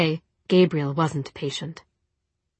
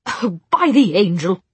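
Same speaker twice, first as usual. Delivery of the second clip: louder exasperated outburst; 6 dB louder than usual. A narrator's reading. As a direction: throw up your hands, loudly. Gabriel loses patience.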